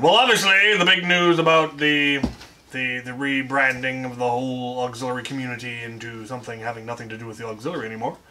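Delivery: ordinary talk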